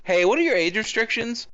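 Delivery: high-pitched eager question; imitated kid caller. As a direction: play a child calling up NASA. Imitates young Riri phoning NASA.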